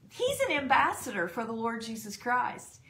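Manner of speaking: usual speaking voice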